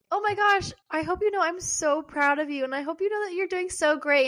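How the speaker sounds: high-pitched gushing voice memo; acted gushing praise. Re-enacts gushing voice memo to friend.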